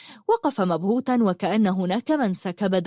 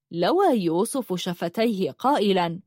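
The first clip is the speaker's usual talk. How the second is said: ordinary delivery, nothing marked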